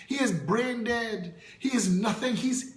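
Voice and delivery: ordinary talk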